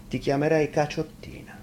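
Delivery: playful nickname. Acted. Shepherd teasing girl with nickname.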